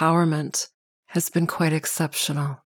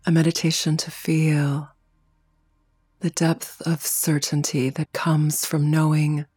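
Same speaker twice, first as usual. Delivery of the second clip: guided meditation. Real, not acted. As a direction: guide them into stillness, unhurried, phrase by phrase. Leads a heart-opening meditation.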